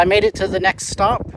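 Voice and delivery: ordinary talk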